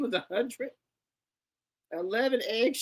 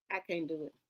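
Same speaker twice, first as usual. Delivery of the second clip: usual speaking voice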